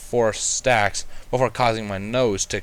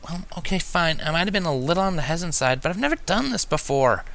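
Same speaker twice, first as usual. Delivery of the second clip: higher, mildly flustered unicorn; flustered protest; 7 semitones higher than usual. A narrator's reading. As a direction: defend yourself, a bit embarrassed. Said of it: "protested, extremely flustered".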